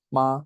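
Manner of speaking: "ma" held high and level; Mandarin first tone. Shown as a demonstration. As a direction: say "ma" on a steady high pitch. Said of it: "First tone is ma".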